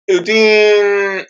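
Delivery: normal talking voice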